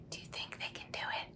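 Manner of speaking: soft breathy aside; whispered question. Real, not acted. Parent asking the child in a whisper. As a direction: lean in and ask quietly.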